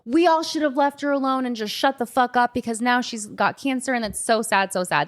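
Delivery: high, put-on pitying voice; hammed-up fake pity; mock sympathetic crowd. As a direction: imitate smug gossipers feigning sadness. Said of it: "everyone's gonna be like".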